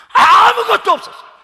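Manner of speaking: high, loud, drawn-out opening word; anguished cry. Real, not acted. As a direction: wail it out from the pulpit. 설교 중 절망을 강하게 강조. (길게 끌며 높게 절규하듯)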